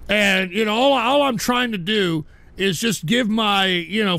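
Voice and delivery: ordinary talk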